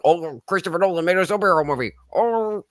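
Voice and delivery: high, sneering mock outrage; mock the fans' outrage, pitched high. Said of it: "And people are like".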